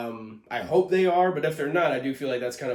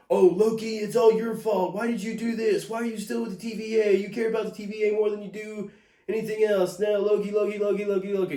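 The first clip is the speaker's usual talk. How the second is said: high, loud whining parody voice; whiny mock Sylvie; 8 semitones higher, 4 dB louder than usual. Reviewer parodying Sylvie nagging Loki, whiny and high. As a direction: nag him like a spoiled whiner, pitched way up. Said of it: "so dead set on".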